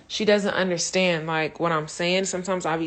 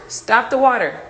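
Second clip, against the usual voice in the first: firm command; 5 semitones higher, 10 dB louder than usual. Real, not acted. Mother telling child to stop water.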